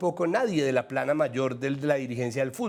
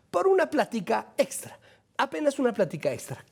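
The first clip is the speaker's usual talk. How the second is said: high-pitched, wheedling mock pitch; mock sales pitch; 8 semitones higher than usual. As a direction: plead for just a little, tongue in cheek. Voices channel's sales pitch mockingly.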